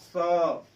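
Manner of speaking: स with warm air pushed out onto the hand; breath-out स. Shown as a demonstration. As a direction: demonstrate स, letting the breath out. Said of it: "इस पे आप बोलिए ऐसे हाथ करके".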